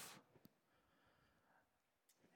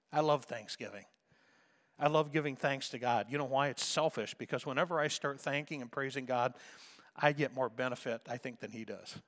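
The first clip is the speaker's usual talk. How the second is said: ordinary talk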